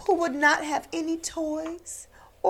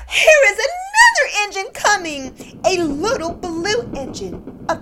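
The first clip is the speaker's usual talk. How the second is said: louder, higher hopeful call; excited call; 5 semitones higher, 6 dB louder than usual. Acted. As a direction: spot the engine and shout for joy. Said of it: "the little clown called out".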